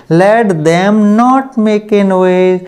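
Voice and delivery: each word drawn out very slowly; slow dictation. Shown as a demonstration.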